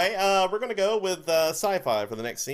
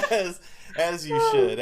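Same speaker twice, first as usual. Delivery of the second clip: laughing; 6 semitones higher, 4 dB louder than usual. Real, not acted. Laughing approval after the scene.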